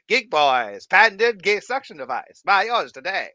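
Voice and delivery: mock ad pitch.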